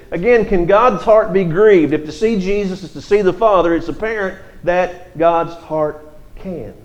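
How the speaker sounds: ordinary talk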